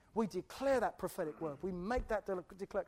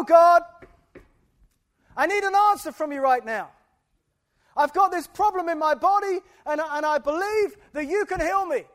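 loud, pleading prayer, higher and louder; acted prayer knocking on heaven's door; 7 semitones higher, 16 dB louder than usual. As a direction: call out to God like knocking on a door. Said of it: "what it looks like is that I knock on that door".

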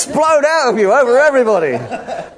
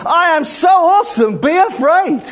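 hammed-up awe-inspiring warning with a laugh; mock-grand declaration from God; 4 semitones higher than usual. Preacher voicing God through David, playfully grand. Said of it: "God is saying through David is".

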